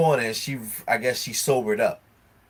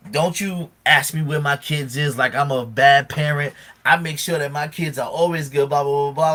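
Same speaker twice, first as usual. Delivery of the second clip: louder, higher imitation of indignation; mock defensive rant; 4 semitones higher, 6 dB louder than usual. Imitating a friend defending her parenting, indignant. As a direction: rattle off an offended retort.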